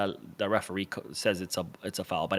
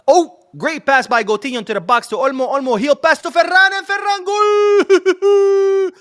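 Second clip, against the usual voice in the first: very high, loud shout building to a long held "goal"; ecstatic goal call; 10 dB louder than usual. Real, not acted. Commentator watching Barcelona score, ecstatic goal call. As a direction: build fast and explode into an endlessly stretched goal cry.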